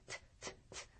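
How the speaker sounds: 트 as crisp bursts of air; unvoiced t. Shown as a demonstration. Teacher showing how the D changes, 트 voiceless. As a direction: release each 트 without any vocal cords. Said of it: "이렇게 바뀐다는".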